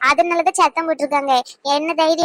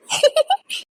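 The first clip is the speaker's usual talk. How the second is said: short high laugh; high nervous giggle; 11 semitones higher than usual. Acted. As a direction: giggle to dodge the scolding. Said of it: "ஏன் சிரிக்கா?". (பதட்டமான சிரிப்பு)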